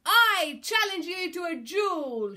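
high, loud, bold declaration; challenging shout. A narrator's reading. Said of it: "shouted Captain Flynn".

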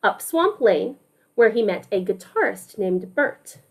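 ordinary delivery

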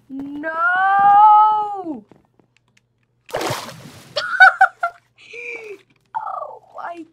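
high loud laughter; laughing scream. Real, not acted. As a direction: shriek and laugh. Near death, laughs.

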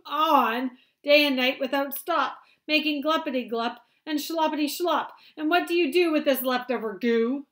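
loud, high scolding; cross Lorax. Acted. Angry Lorax scolds the Once-ler about the goo, loud. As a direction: scold the polluter. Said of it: "his dander was up".

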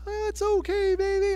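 high soothing coo; mother calming a child, high and gentle; soothing mommy voice. Retold or imitated. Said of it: "mommy comes in with this voice".

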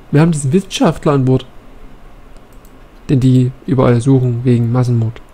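normal talking voice

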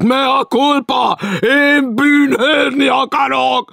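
very high, slow, loud wailing shout; full tragic shout. A narrator's reading. Stranger loudly begs punishment, shouting tragically. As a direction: bellow repentance at the top of the voice. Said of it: "Kiáltott".

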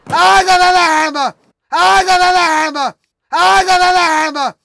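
high, loud triumphant yell; excited yelling. Real, not acted. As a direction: shout it out in triumph.

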